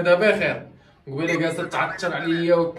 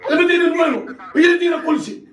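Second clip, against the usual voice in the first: high, loud shout of blame; shouted accusation; 12 semitones higher, 5 dB louder than usual. Acted. Accused man shouts to the chief that she is to blame, high loud yell. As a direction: yell that she did it all.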